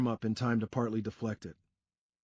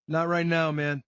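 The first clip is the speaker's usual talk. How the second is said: Sean brushing off; 5 semitones higher, 5 dB louder than usual. A narrator's reading.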